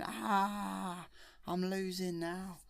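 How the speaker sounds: lightly voiced villain admitting defeat; a hint of Darth Vader. Darth Vader losing to Luke, a mild pained groan.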